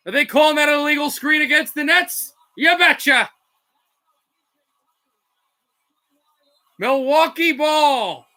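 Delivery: energetic basketball call; broadcast-style play-by-play; live play-by-play. Acted. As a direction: ride the action as it happens.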